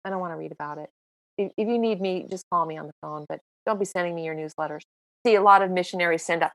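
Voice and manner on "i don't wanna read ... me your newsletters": low, much softer quoted friend; low, soft friend on the phone saying no newsletters; gruff friend. Retold or imitated. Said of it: "he calls me, he goes".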